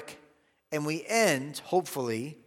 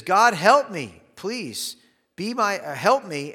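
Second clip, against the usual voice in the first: desperate plea; 5 semitones higher, 6 dB louder than usual. Retold or imitated. David begging God for help.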